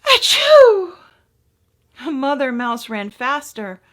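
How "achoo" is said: two high, loud little sneezes; tiny sneeze. Acted. Cold baby mouse sneezing in the wind, high and loud. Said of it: "and sneezed".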